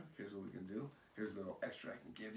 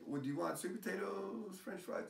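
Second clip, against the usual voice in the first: lightly acted server, pitched up high; restaurant server; 10 semitones higher than usual. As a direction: offer the choices in a higher server voice. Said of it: "When you go to Red Robin".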